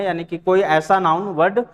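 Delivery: ordinary talk, nothing marked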